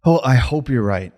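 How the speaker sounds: ordinary delivery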